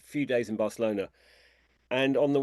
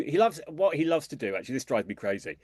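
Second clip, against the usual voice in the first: ordinary talk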